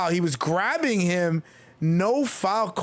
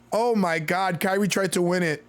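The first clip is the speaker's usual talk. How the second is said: ordinary talk, nothing marked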